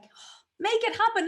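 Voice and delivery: high, bright exclaim of the slogan; excited reading. Retold or imitated. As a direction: read it out high like a sudden revelation.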